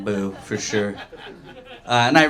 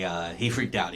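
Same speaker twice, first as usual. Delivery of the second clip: ordinary talk, nothing marked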